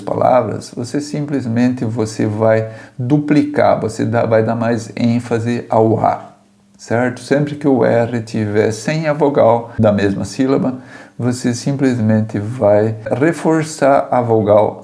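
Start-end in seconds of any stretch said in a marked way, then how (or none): none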